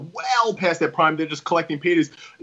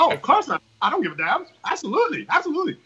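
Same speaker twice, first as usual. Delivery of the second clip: high-pitched imitation Australian woman; not-so-good Australian accent, higher voice; bad Australian accent; 9 semitones higher, faster than usual. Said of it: "in her Australian accent, I don't have a good Australian accent".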